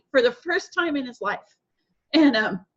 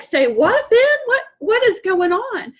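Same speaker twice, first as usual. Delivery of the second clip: surprised; 10 semitones higher, 5 dB louder than usual. Retold or imitated. Mother's surprised reaction retold.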